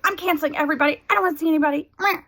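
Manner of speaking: high, exasperated announcement; fed-up outburst. Retold or imitated. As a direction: cancel everyone in a huff. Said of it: "I was like".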